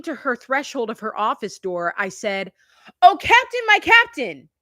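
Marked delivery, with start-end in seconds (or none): 3.0-4.5 high, loud dramatic declaiming; theatrical recitation. Retold or imitated. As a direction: proclaim it like a stage poem to the boss. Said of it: "just out of nowhere".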